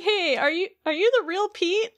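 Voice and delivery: mild Goofy impression, curious; Goofy asking. Goofy voiced questioning.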